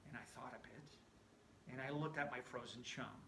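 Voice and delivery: usual speaking voice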